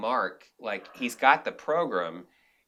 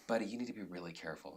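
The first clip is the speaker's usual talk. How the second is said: quiet warning; 9 dB quieter, faster than usual. Retold or imitated. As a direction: pull him aside and caution him under your breath. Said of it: "he pulls me aside and he goes".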